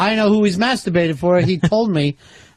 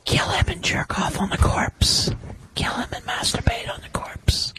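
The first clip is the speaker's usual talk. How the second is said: slow, soft demon whisper; scary demon voice; 5 semitones higher, 6 dB quieter than usual. Pretend. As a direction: whisper like a sinister devil.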